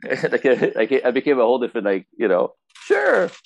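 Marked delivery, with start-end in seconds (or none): 2.8-3.3 high, sing-song mock sure; sudden eager sure. After the scolding, a high mock-eager sure. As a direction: flip to instantly agreeable.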